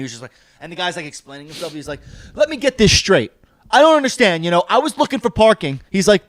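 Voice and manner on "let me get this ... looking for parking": high, loud arguing customer; retold aggrieved protest; indignant complaint. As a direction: imitate a diner making his case, louder. Retold customer arguing about valet.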